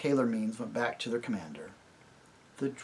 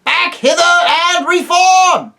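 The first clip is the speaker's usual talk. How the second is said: very high, loud distant command; a commander calling retreat from far off; calling out from afar; 14 dB louder, much slower than usual. Acted. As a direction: call the retreat across the field. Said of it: "calling out from the distance".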